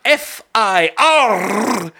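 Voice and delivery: high, slow spelled letters; slow spelling. Pretend. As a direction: draw out each letter. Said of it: "That's not what you're saying".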